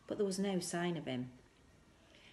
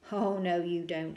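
a firm, louder no; firmly; 2 semitones higher, 7 dB louder than usual. Acted. Mother gripping her son's arm refuses to let go, firm. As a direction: stop him wriggling free, firmly. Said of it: "she said firmly".

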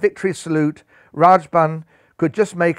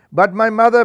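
higher, louder desperate plea; pleading; 6 semitones higher, 5 dB louder than usual. A narrator's reading. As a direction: beg to stay for her mother. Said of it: "pleaded Rose Patel".

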